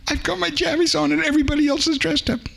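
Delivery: put-on high, fast little boy voice, worried; a small child fretting about his pajamas. A narrator's reading. Orphan boy anxiously telling the lady he's in pajamas.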